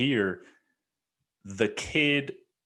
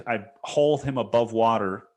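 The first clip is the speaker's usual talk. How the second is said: ordinary talk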